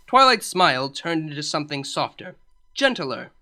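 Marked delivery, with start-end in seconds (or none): none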